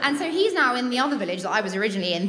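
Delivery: ordinary talk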